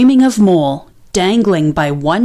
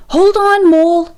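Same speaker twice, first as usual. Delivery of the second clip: higher, louder call up the tree; urgent cry; 8 semitones higher, 4 dB louder than usual. A narrator's reading. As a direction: shout to hold him steady. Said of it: "he cried".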